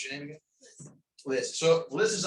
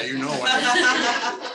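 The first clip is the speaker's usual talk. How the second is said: laughing; 10 semitones higher, 8 dB louder, slower than usual. Real, not acted. Laughing aside about breeze.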